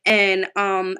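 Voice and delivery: normal talking voice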